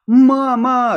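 high, loud frightened cry; crying out for mum. A narrator's reading. Boy crying out to his mother on waking, frightened shout. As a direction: call your mother out of the nightmare. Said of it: "закричал я".

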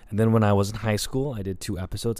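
usual speaking voice

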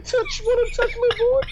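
high squeak. Acted. Mocking high voice.

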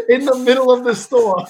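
high, loud incredulous retelling; loud outrage. Retold or imitated. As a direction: exclaim it over the laughter. Retelling father's public outburst.

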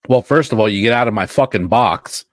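joking shout at the cohost; mock outburst. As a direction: bark at him to get out.

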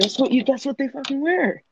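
high-pitched, exasperated comeback; indignant protest. Real, not acted. Friend mocked for saying spandex fires back, indignant and high-pitched. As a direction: protest it, pitch climbing right up. Defending himself after being mocked.

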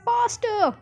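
very high-pitched urging shout; urgent cry. A narrator's reading. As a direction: urge them on in a high cry. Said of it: "cried Mister Wonka".

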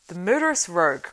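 loud exclamation of outrage; outraged cry. A narrator's reading. Éomer crying out at the thrown globe, loud. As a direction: cry out against a villain. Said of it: "cried Éomer".

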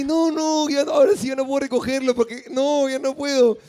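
frantic high pleading, playing yourself; retold panic. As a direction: act out a flustered refusal, voice up high. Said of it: "Y yo así".

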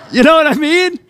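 high, loud, teary laughter while telling it; laughing through tears. Real, not acted. Pastor retelling son's big tackle, laughing emotionally. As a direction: laugh and choke up at once. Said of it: "why do I get emotional?".

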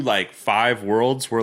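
usual speaking voice

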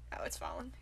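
soft unvoiced aside; whispering. Real, not acted. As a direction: breathe it out in a whisper. Checking heart rate, aside.

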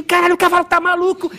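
high, loud alarmed cry; hammed-up retold alarm; mock panic. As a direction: shout a warning about a wild horse. Hippie panicking at bucking horse.

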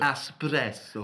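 slow Italian accent on Espresso, rolling the r; Italian-style espresso. Shown as a demonstration. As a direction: draw out Espresso like an Italian barista. Italian-style word demo.

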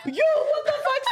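high laughing shout of disbelief; laughing mock yell. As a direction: squeal it out through laughter. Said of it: "Some other car put us up".

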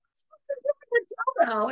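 very high, quick squeal; mock bewilderment. Friend handed an unexpected gift, squealed mock bewilderment.

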